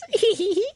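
a squeaky, high-pitched mock cackle; Ed's high cackle. Friend mimics how Ed laughs now, a high mock cackle. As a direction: cackle high and thin, like Ed gone mad. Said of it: "That's how Ed laughs now".

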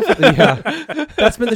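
high laughing yeah. Real, not acted. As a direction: laugh through it.

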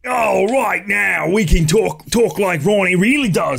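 drawn-out mock British "real voice"; hammed-up British accent. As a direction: lay on a thick, silly British accent. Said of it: "step outside of that for a second".

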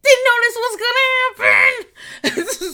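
very high, loud crying wail, slow; mock anguished wail. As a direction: wail it like a betrayed fan. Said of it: "And you're like".

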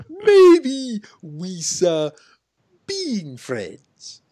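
loud, high-pitched blustering character impression, settling at the end; Boss Nass. Quoting a favorite movie character for co-hosts, exaggerated impression. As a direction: boom it out high, then let it drop. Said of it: "I love Boss Nass in this scene".

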